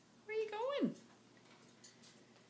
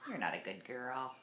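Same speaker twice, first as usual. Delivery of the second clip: playful scolding in a much lower voice; mock scolding; 11 semitones lower, 4 dB quieter than usual. Owner mock-scolds her parrot in a low teasing voice. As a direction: drop the voice low and tease the bird.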